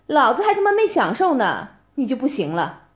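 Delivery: usual speaking voice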